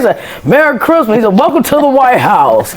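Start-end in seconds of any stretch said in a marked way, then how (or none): 0.5-1.2 higher, measured holiday greeting with a lighter touch; a president greeting a choir student; Obama's voice. Retold or imitated. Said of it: "you got a good Obama impression". 1.4-2.7 Obama's voice. Retold or imitated. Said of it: "you got a good Obama impression".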